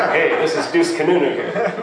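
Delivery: laughing, showy card-sharp voice; riverboat gambler. Retold or imitated. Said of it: "sounds like a riverboat gambler".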